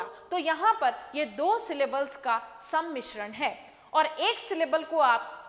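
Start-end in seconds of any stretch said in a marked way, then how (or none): none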